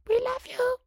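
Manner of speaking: very high, slow squeaky fan voice; silly made-up voice. Pretend. Host voicing a listener's kind message, very high and slow. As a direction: put on a tiny high cartoon voice. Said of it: "Why did I use that voice?".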